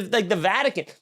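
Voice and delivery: ordinary talk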